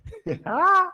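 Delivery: a sudden high-pitched laugh; high burst of laughter. Real, not acted. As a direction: break into a squeaky laugh. Teacher laughs at own slip. (risada aguda)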